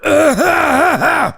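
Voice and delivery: real high-pitched burst of laughter; loud high laugh. Real, not acted. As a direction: laugh loud and high after straining the throat. Laughing after throat hurt.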